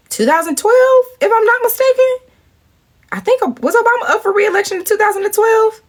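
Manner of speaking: high-pitched, uncertain asking; high, comically doubtful; unsure questioning. Real, not acted. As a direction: question your own memory in a squeaky voice. Unsure of the year, checking memory.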